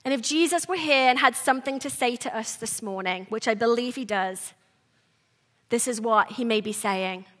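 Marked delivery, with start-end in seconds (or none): none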